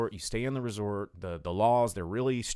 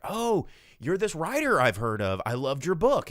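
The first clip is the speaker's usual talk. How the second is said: high, loud eager fan gush; 8 semitones higher, 7 dB louder than usual. Retold or imitated. Mia Goth's character gushing to the writer, eager and loud. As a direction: gush over him like a starstruck fan.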